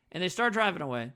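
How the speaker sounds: ordinary talk, nothing marked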